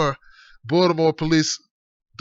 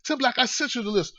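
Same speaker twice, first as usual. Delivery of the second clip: put-on George's accent; 3 semitones higher, 5 dB quieter than usual. Host mimicking a friend about a list, mock accent. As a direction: impersonate a friend's accent, quietly pitched up. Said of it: "George's like".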